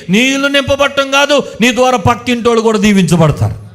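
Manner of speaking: loud, high, rousing sermon climax; full-force preaching. Acted. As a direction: preach it out to the whole church. (గట్టిగా, ఉత్సాహంగా ప్రసంగం)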